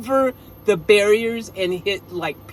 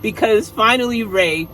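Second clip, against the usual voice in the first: ordinary delivery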